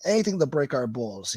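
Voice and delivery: ordinary delivery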